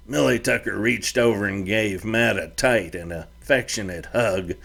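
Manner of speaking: plain reading voice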